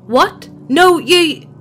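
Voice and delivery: alarmed protest. Acted. Kid protests being targeted.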